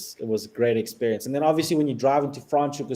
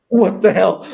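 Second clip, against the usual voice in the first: higher and louder, startled; startled disbelief; 9 semitones higher, 11 dB louder than usual. Retold or imitated. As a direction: caught off guard at the zebra. Surprised by zebra at night.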